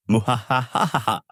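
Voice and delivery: hammed-up villain laugh; mocking laugh. A narrator's reading. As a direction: cackle it out like a smug villain. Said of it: "laughs as he says".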